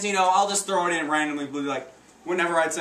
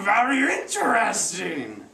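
slow, high, hammed-up alien sage; intrigued little guru, slow and high-pitched; Master Yoda; 6 semitones higher, 3 dB louder, slower than usual. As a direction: draw it out in a higher, put-on creature voice. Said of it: "like watching a movie with Master Yoda".

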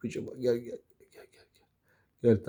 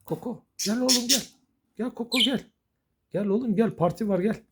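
high-pitched coaxing call; calling a pet; 8 semitones higher than usual. Real, not acted. Owner calling a pet to the party, high coaxing.

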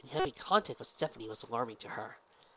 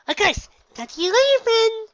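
very high, loud bubbly pony voice; Pinky, exclaiming; 14 dB louder than usual. Acted. Pinky introduces herself to the gym leader, very high and bubbly. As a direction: chirp it out, much higher and louder.

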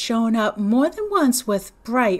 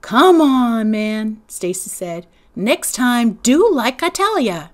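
scolding; 2 semitones higher, 8 dB louder, slower than usual. Acted. Big brother scolding little one.

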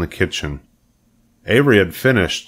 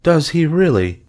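higher, distracted-sounding question; vague; 10 semitones higher, 3 dB louder than usual. A narrator's reading. Missus Arable answers her daughter, vague and worried. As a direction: respond vaguely, pitched up. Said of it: "said Missus Arable rather vaguely".

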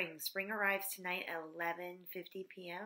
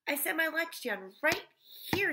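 put-on raised, pleading kid voice; a girl insisting, louder and higher; insistent child voice; 8 semitones higher, 6 dB louder than usual. A narrator's reading. Mauricia insists on lunch spot.

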